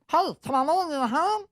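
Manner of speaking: high-pitched little elf voice; tiny high voice. Retold or imitated. Tour guide offering help, quoted.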